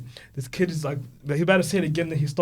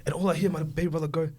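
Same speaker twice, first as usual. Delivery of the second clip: usual speaking voice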